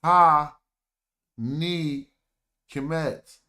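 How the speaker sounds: Ta punched loud, then Ni, Kemet apart; syllable by syllable. Shown as a demonstration. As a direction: count out Ta, Ni, Kemet. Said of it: "Here goes another one".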